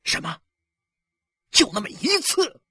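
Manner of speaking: high-pitched incredulous question; outraged disbelief. Acted. Husband hears his wife's confession, outraged. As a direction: echo it in shocked fury.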